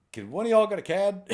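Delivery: high breathless ask. Retold or imitated. Asking cops after fight.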